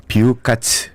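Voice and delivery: bifu said Japanese style; 비후까츠 said as Japanese bifukatsu; Japanese accent korean. Shown as a demonstration. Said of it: "비프를 일본식으로 발음하면".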